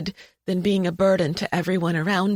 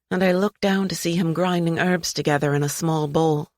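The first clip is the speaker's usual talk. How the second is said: usual speaking voice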